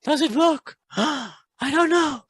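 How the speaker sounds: high panicky mock voice with a gasp; scared bunny. Coach imitating a scared beginner trader, high mock panic. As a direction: mimic a frightened trader fretting over money. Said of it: "like, a scared bunny".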